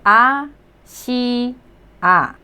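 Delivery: "a, si, a" drawn out, a pause between each; syllable by syllable. Shown as a demonstration. Teacher breaking a Korean word down for learners, syllable by syllable. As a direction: sound out "a, si, a" one syllable at a time. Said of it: "The first syllable and the third syllable".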